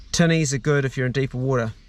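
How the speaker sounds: ordinary talk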